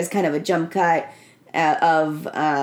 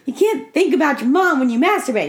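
mildly appalled retold scolding, pitched higher; a touch of scandalized protest; acted exasperation; 6 semitones higher, 3 dB louder than usual. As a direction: object in mock dismay. Retold character scolds Manny in dismay.

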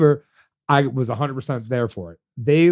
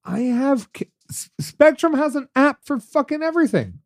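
heated rant; 10 semitones higher than usual. Real, not acted. Heated rant about cable.

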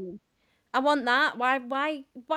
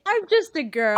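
mock girly; 7 semitones higher, 6 dB louder than usual. Mock contrast with broad line.

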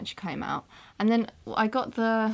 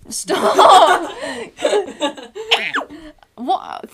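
much louder and higher, laughing; laughing shriek; 9 semitones higher, 10 dB louder than usual. Real, not acted. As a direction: shriek at her to stop while cracking up. Laughing protest at interrupting friend.

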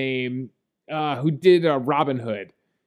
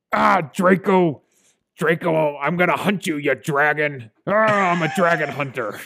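growled, pirate-like attempt at a British accent; Dennis Quaid's bad British accent. Podcast host mocking the film's dragon hunter, growly bad British accent. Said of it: "let's do our best Bowen impression".

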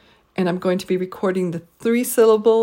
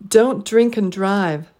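Don't, drink, drive stressed slowly, and unstressed; slow Don't drink and drive with a weak and; deliberate three-beat Don't drink and drive; three stresses, one weak; 4 dB louder, slower than usual. Shown as a demonstration. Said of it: "three stressed syllables and one unstressed syllable".

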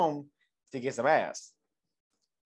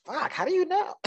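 high, startled disbelief; shocked surprise; 13 semitones higher than usual. Retold or imitated. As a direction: blurt it out amazed. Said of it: "I was like".

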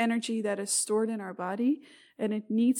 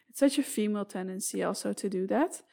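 normal talking voice

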